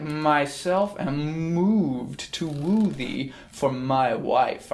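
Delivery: slow seductive reading; wooing. Shown as a demonstration. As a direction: croon the line to win her. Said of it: "he's wooing her with that sound".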